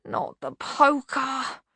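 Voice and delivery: whimpering bogeyman, drawn out; whining. A narrator's reading. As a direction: whine it pleadingly, dragging the words. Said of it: "whined the bogeyman".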